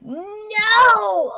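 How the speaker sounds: a loud, high, fed-up "No!"; exasperated no. Real, not acted. To her cohost over the color, a loud exasperated no. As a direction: shout it down in disbelief.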